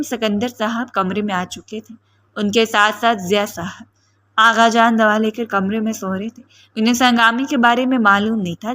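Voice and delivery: ordinary delivery, nothing marked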